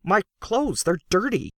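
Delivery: a fussy man pointing at his dirt; pleading protest. A narrator's reading.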